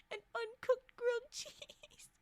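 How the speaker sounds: high, squeaky giggle through the words; breathless giggling; laughing. Real, not acted. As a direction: dissolve into laughter as you say it. Laughing at her own joke.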